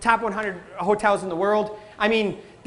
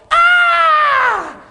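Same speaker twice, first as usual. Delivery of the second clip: high, loud mock baby wail; 10 dB louder than usual. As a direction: wail like a toddler fishing for attention. Kid falls, cries for attention.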